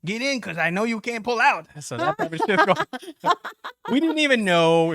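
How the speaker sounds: high, laughing delivery; cracking up at his friends' joke, high and laughing. Real, not acted. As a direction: laugh through the punchline.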